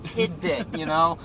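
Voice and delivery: put-on a small, sparing voice for a skinny kid; skinny little kid. Sandwich kid doling out mustard, dainty high voice. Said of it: "skinny little kid who's like".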